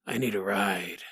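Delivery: low, quiet stranger's request; ominous hitchhiker. Acted. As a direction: drop low and soft, like a creepy ghost-story hitchhiker. Spooky man repeats his request.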